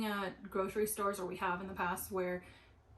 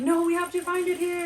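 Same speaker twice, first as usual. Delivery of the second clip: higher and much louder desperate insisting; retold upset protest; 9 semitones higher, 11 dB louder than usual. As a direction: plead loudly, close to tears. Upset insisting in store scene.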